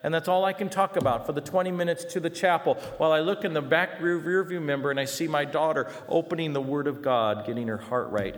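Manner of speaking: usual speaking voice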